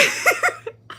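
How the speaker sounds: high breathy burst of laughter. Real, not acted. Telling friends about the border, bursting into laughter.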